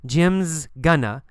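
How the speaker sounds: "Jim's gonna" slowly, z clearly sounded; reduced going to. Shown as a demonstration. Teacher equating short and full forms, slow "Jim's gonna". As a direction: contract "Jim's" and blend "gonna".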